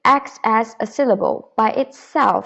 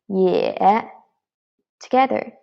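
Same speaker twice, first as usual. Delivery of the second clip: normal talking voice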